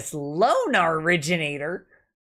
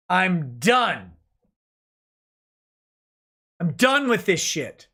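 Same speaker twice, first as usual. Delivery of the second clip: ordinary talk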